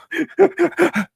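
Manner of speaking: high gasping sob, retold; sharp high gasp for breath; imitated sob gasp. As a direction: show the choking gasp. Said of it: "like that".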